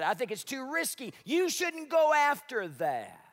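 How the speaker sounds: high, slow, mock-worried warning; discouraging naysayer. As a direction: talk them out of it. Naysayers warning against risk.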